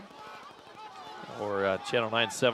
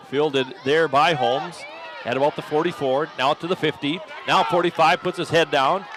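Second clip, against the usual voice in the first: louder building return call; excited call; 3 semitones higher, 5 dB louder than usual. Real, not acted. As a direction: ride the return up the field. Live punt return call.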